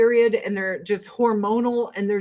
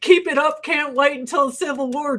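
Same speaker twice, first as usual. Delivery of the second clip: mock eager crowd; 4 semitones higher than usual. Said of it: "everyone's like".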